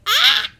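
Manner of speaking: a high, loud squeal of pure delight. Real, not acted. Fan squealing over Southern accents, high loud squeal. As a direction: shriek with glee at the phrase.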